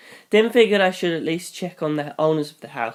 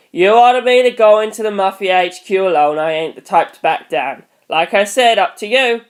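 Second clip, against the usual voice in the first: louder, blunt tough-guy voice; tough cop; 3 semitones higher, 6 dB louder than usual. Acted. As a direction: push back firmly. NYPD Blue replies.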